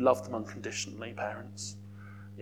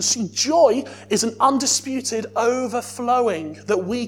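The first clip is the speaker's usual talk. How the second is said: louder, building fervent preaching; full-force preaching; 5 semitones higher, 7 dB louder than usual. Acted. Preacher urging the church toward worship, loud fervent preaching. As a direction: drive the joy home to the congregation.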